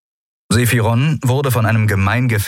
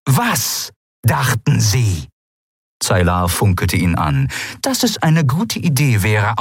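scornful captain; 8 semitones higher than usual. Acted. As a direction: drag out the mockery. Captain scornfully repeats his words.

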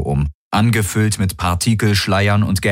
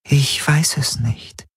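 higher, slow whisper, unsure; 7 semitones higher, slower than usual. A narrator's reading. The changing figure whispers to Garland, unsure. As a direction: breathe it out, lost and afraid. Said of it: "sagte sie".

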